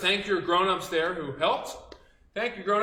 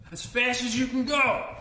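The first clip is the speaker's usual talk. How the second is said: higher, louder cheering on; eager urging; 7 semitones higher, 6 dB louder than usual. Real, not acted. Teacher urging kids to sprint, eager and louder. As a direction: hurry them on. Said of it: "show me in place sprinting".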